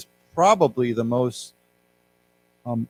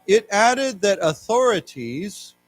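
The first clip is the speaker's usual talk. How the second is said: slow, deliberate reading; reading aloud for class; 4 semitones higher, slower than usual. Shown as a demonstration. Teacher reading the news text aloud to students, slow. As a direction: read each phrase slowly for the learners. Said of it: "let's read this together".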